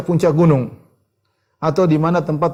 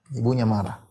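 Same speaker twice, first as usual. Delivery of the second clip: soft echo; 3 semitones lower, 6 dB quieter than usual. Shown as a demonstration. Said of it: "akhirnya ibunya marah".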